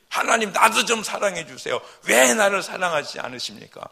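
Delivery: high, loud grumbling plea; complaining protest. Retold or imitated. A believer grumbling and protesting at God. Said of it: "원망이고 불평이고 항의지".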